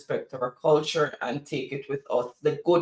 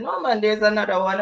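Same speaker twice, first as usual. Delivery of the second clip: higher-pitched Jamaican patois reply; 6 semitones higher, faster than usual. Retold or imitated. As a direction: retell your answer in lively Jamaican patois. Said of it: "So we started talking patois".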